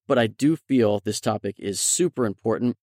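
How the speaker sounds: ordinary talk, nothing marked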